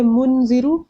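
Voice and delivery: मुनजिरु with a plainly sounded n; wrong, full noon. Shown as a demonstration. Teacher repeating the mistake, noon fully pronounced. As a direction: make the n in मुनजिरु distinct before z. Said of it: "गलत हो गया".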